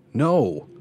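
high, loud, adamant denial; firm emphatic refusal. A narrator's reading. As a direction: shoot it down loudly. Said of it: "I replied adamantly".

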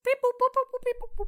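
high, loud beep boop robot noises. Acted. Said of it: "I'm only here for the sound effects".

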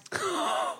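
a sharp delighted gasp. Real, not acted.

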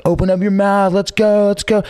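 high, rowdy party call; rowdy drunk hype. Retold or imitated. Drunk bartender pouring shots.

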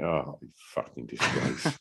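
low, slow mock disgust at himself; self-scolding. Watching his own slow boxing back, low disgust. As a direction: mutter it in disgust at your own video.

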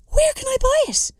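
desperate girl craving fake tan. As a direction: beg for the answer breathlessly. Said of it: "I desperately inquired".

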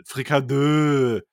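"Frikadeux" said slowly as French fri-ka-DØ. Shown as a demonstration. Host mocking the French word, drawn out. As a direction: stretch "Frikadeux" with a French ending. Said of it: "Frikadeux, langweilig".

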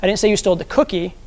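"cookie" punched at the end of the sentence; emphasis on the last word, "cookie"; stress on COOKIE. Shown as a demonstration. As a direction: lean on "cookie", as if it was something else. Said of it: "wherever you put the emphasis".

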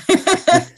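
short loud, high laugh; brief burst of amusement; real laughter. Real, not acted. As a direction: break into a quick laugh. Amused by own pun.

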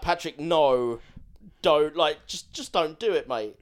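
mock desperate shout; exasperated plea. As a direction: beg Patrick to drop it, loud and exasperated. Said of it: "you're like".

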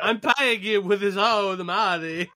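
high, slow fake Russian accent; mock Russian accent. Quoting Malkovich's villain, slow hammy Russian accent. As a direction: drag it out in a bad Russian accent. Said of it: "World's greatest accent".

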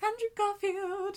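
slow, high, awed gasp of his name; starstruck. Retold or imitated. Starstruck at seeing the actor.

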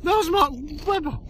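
high, squeaky excited report; imitated starstruck friend. Teasing her by mimicking her starstruck excitement, high and squeaky. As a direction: mimic her gushing like a fan. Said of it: "You're like".

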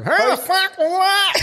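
high, muffled voice as if through a snorkel; Snorkel impression. Mate imitating Snorkel from the port, high snorkel-muffled voice. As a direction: talk like a man with a snorkel in his mouth. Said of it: "he come into the crib room".

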